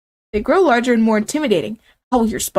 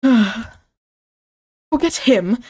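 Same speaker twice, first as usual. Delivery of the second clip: long sad sigh; much slower than usual. Acted. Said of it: "you hear a long, sad sigh".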